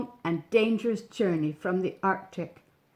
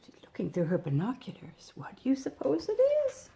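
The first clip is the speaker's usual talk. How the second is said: whisper; 4 semitones higher, 6 dB quieter, faster than usual. Real, not acted. Aside to child over picture.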